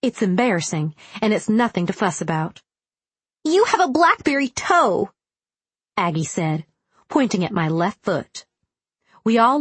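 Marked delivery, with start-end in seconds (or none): 3.4-5.1 put-on bright, high childlike voice; high girl voice. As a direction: pitch it way up for a small girl. Aggie voiced in the story.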